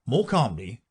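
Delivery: plain reading voice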